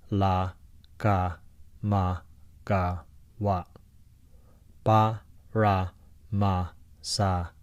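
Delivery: "Ba, ka, wa" read as a syllable chain. Shown as a demonstration. Teacher reading the Roman letters as syllables. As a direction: read each syllable with an inherent a. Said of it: "So it reads like this".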